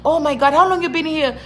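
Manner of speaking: high-pitched mock enthusiasm; gushing praise. People praising a newcomer's broken English, mock gushing. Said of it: "They're like".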